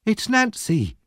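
high-pitched cry of recognition; blurted out. A narrator's reading. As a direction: blurt it out in surprise. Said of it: "he said without thinking".

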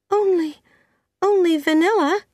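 put-on high, hesitant girl's voice; anxious young Anne. A narrator's reading. Anne answers Marilla about the cake, high and hesitant. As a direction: answer haltingly, pitched right up.

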